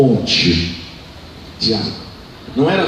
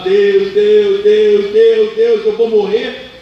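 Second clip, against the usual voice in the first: high, loud desperate wail; crying out to God; 6 semitones higher, 6 dB louder, much slower than usual. Acted. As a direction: cry out to God in panic, voice raised high. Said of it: "voltou e começou a chorar".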